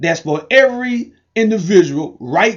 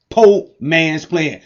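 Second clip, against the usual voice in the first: each word drawn out slowly; slow emphatic name; much slower than usual. Shown as a demonstration. Pitchman slowly stamping the brand name for viewers. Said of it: "Say it with me".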